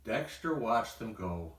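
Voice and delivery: usual speaking voice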